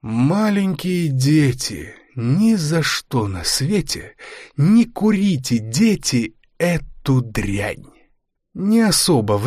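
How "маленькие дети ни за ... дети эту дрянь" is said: slow poem recitation. Acted. Recites a rhyme.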